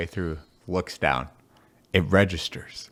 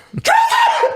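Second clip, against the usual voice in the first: high, loud cackle of laughter; shrieking laugh; 8 dB louder than usual. Real, not acted. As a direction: burst out laughing. Laughing while retelling the fall.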